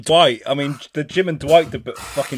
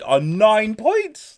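dramatic reveal; 8 semitones higher, much slower than usual. Real, not acted. Host announces a tie.

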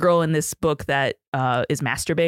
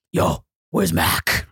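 low, slow, breathy tough-guy growl; 12 semitones lower, much slower than usual. Retold or imitated. Imitating the enforcer at the door, low and slow. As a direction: drop way down like a menacing enforcer. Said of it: "And he's like".

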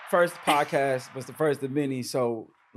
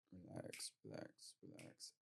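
soft breathy whisper; 13 semitones lower, 26 dB quieter than usual. Acted. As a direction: whisper it to settle them. Breath hold in meditation.

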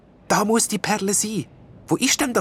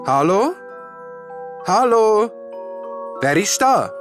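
higher, calling out to sound brave; deliberately firm voice; 12 semitones higher than usual. A narrator's reading. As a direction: force a steady voice through the fear. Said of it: "mit einer bewusst festen Stimme".

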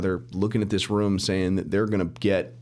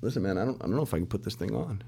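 softer, hesitant and rushed; nervous hush; 5 dB quieter, faster than usual. Retold or imitated. Telling his partner he can't wear the suit, nervous and hushed. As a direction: mumble it like you're losing your nerve. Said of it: "I said".

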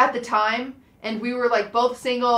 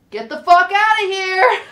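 mock shooing off; 8 semitones higher, 8 dB louder, slower than usual. Shooing away high school friend.

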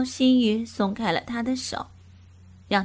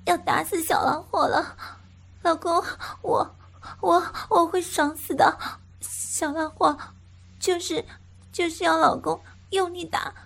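woman crying out; 7 semitones higher than usual. Acted. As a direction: cry out, losing control. Female character crying out.

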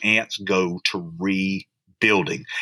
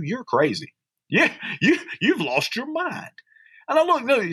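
much higher, fervent preaching; fervent pulpit preaching; 8 semitones higher than usual. Real, not acted. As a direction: proclaim the verse, stretch the joy. Preaching verse ten.

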